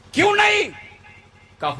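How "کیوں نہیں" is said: high, sharp challenge; saint's astonished query, acted out; acted incredulous question. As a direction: demand the reason, pitched way up.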